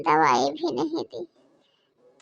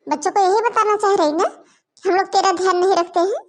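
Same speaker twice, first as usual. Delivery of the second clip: mock her bitterly, voice raised; furious taunting; 3 semitones higher, 4 dB louder than usual. Mother-in-law taunting her sick daughter-in-law, loud and sarcastic.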